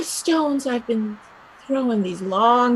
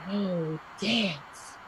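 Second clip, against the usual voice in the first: much softer, dreamy hush; 5 semitones lower, 15 dB quieter than usual. Acted. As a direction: breathe the word dance. Dreamy hushed wish.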